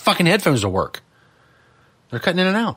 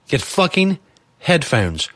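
ordinary delivery

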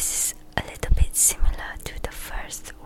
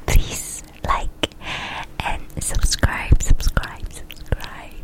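whispered request; much slower than usual. Real, not acted. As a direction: whisper it close and slow.

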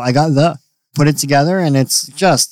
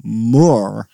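a low, tentative Australian moo; Australian accent attempt; 5 semitones lower than usual. Shown as a demonstration. Bandmate put on the spot to moo, attempted Australian accent. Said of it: "say moo in an Australian accent".

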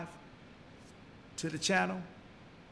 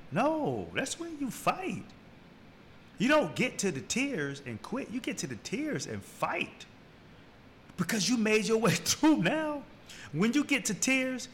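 rising passionate exhortation; 7 semitones higher than usual. Real, not acted. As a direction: urge them to keep going, voice climbing. Motivational peak, fighting through tears.

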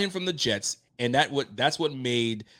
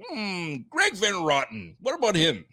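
mock pondering; 7 semitones higher than usual. Mocking co-host's musing.

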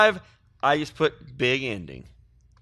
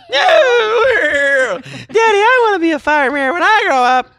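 put-on high, loud crying kid voice; whining child; 13 semitones higher, 8 dB louder, slower than usual. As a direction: wail like a child begging dad. Kid in mock toy ad.

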